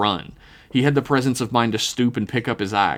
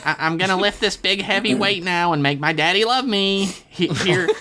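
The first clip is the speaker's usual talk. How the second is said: put-on high, strained loser-kid voice, halting starts; nervous teenage Dev; 10 semitones higher than usual. A narrator's reading. Dev announcing his lift to the room, high and nervous. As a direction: stretch it out high and hesitant. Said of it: "Dev said loudly".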